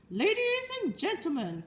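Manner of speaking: high, ringing public-address call; loudspeaker announcer. Acted. Loudspeaker addressing the fair crowd, grand announcer. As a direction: hail the whole fairground. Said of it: "continued the loudspeaker".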